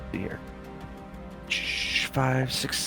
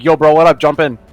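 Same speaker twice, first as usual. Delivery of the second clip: mock casual call-out; 3 semitones higher, 9 dB louder than usual. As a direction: call your buddy over to hop in, loudly. Acts calling friend into truck.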